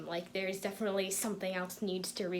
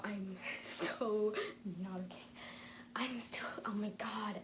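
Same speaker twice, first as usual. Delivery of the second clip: whisper; 6 dB quieter than usual. Real, not acted. Overwhelmed, whispering.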